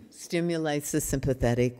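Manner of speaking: ordinary talk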